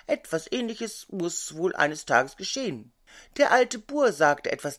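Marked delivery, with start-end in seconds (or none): none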